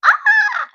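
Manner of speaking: sudden high shriek of alarm; panicked yelp. Retold or imitated. Sick in Malaysia as the pandemic hits, shrieking in alarm. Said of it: "I was like".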